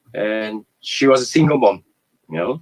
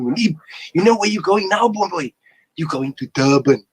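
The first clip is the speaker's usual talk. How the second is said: excited mom; 6 semitones higher than usual. Retold or imitated. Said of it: "she told me".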